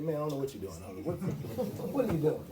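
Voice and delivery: lightly voiced buddy, relaxed and plain; just let the friend's words out casually; easygoing quote. Retelling colleague Fred's puzzled question, relaxed mild voice. Quoting his old colleague Fred.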